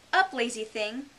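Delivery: loud, commanding queen voice; imperious queen. Acted. The Queen waking the sleeping Griffin, imperious. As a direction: order a lazy servant up.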